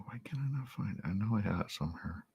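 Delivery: whispered to himself while searching; whispering to himself while hunting for the video. Real, not acted. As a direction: mutter it under your breath, voiceless.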